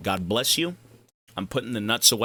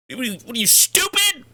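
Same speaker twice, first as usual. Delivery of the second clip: yelling in disbelief at a teammate; higher and louder, cracking on stupid; incredulous mock yell; 12 semitones higher, 3 dB louder than usual. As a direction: pitch shoots way up on stupid. Retold argument with banning player.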